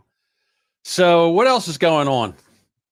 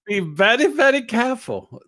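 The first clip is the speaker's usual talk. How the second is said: a hint of Elmer Fudd; 9 semitones higher, 3 dB louder than usual. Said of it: "I was doing Elmer Fudd".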